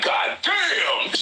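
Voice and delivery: high, shouted God damn; amazed shout. Retold or imitated. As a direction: yell it in shock. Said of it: "I was like".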